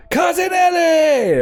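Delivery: high, slow shouted fight intro; ring announcer. Acted. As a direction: drag out every word to the crowd. Announcer calls champion's name.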